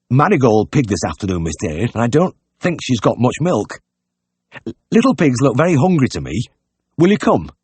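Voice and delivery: fretful innkeeper, ending high; worried pig-keeper. Acted. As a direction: plead for the vet, pitch climbing.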